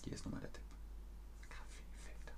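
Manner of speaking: a secret clue, whispered; whispered hint. Real, not acted. Teacher slipping students a hint, whispered. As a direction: whisper the tip softly.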